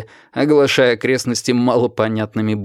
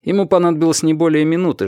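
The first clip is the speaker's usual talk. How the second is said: ordinary delivery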